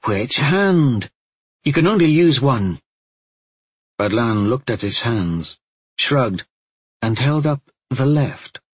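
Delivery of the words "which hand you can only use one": put-on higher, mildly exasperated girl; understated impatience, pitched up. A narrator's reading. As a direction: press the point with a touch of exasperation. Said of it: "in her exasperation".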